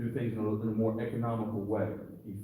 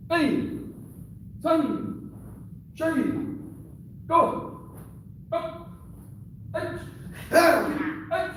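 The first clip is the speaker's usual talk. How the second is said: very high, short, separated calls; shouted drill count; 4 dB louder, much slower than usual. Real, not acted. Sensei counting the drill for students, high shouted commands. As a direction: call each count like a karate instructor.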